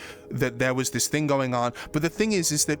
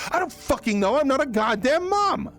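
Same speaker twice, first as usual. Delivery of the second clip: exasperated retort; 10 semitones higher than usual. Retold or imitated. His own exasperated retort quoted.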